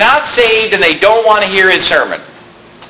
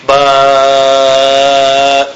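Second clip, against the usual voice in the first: put-on childish drone; 6 semitones lower than usual. Shown as a demonstration. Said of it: "we'd plug our ears and say".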